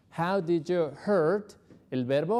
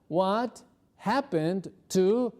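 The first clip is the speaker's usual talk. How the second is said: slow model question; 3 semitones higher, 3 dB louder, much slower than usual. Shown as a demonstration.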